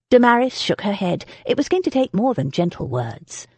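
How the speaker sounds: plain reading voice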